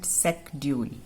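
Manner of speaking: "secdule" with a K sound, the H silent; current sk pronunciation. Shown as a demonstration. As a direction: say "secdule" as "sek-dyool". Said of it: "now we pronounce it". ("secdule" को "सेकड्यूल" बोलें)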